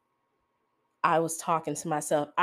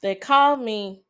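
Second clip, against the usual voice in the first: holding back tears; 2 semitones higher, 3 dB louder than usual. Real, not acted. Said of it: "I'm gonna try not to cry".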